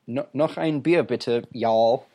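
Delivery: ordering another beer in German, y'all shouted as the punchline; joking y'all shout. Pretend. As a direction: playfully yell the y'all. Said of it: "You should just end it with a y'all every time".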